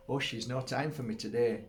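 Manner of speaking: ordinary delivery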